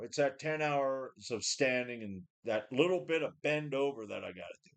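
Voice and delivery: ordinary delivery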